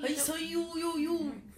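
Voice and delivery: high, loud rapper-style hype; hyped-up greeting. Acted. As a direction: chant the greeting like an MC.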